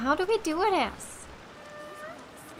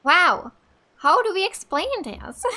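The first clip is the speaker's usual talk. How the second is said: louder, higher astonished outburst; stunned wow; 4 semitones higher, 7 dB louder than usual. Real, not acted. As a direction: blurt it out, caught off guard by the question. Stunned by the question of identity.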